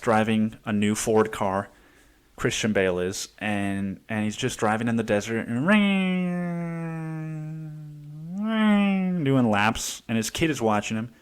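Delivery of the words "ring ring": rising vocal engine whine; high mock engine rev; engine rev imitation. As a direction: mimic a car screaming past. Imitating the test car revving.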